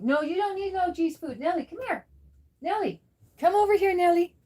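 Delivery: high, sing-song cat-calling; high-pitched pet-owner calling. Real, not acted. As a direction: coax the cat away in a high voice. Calling cat away from food.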